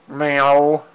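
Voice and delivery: a light meow at the cat; mock meow. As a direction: meow back casually. Meowing at her cat.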